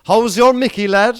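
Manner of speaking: lightly put-on country lad greeting; cheeky country greeting, lightly voiced; mock local greeting. Shown as a demonstration. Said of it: "you have to say".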